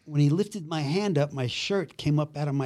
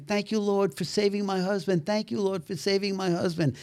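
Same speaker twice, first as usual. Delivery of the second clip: higher, softer devout voice; imitated praying wife; 5 semitones higher, 5 dB quieter than usual. Wife thanking God for her husband, high soft imitated prayer. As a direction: lightly mimic a wife lost in grateful prayer. Wife's repeated prayer, eyes closed.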